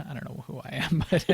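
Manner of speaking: ordinary talk, nothing marked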